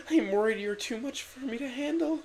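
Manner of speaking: ordinary talk, nothing marked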